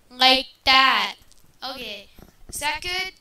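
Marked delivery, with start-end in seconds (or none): 0.1-1.1 loud, booming demo into mic; loud mic test. Shown as a demonstration. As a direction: boom it into the mic.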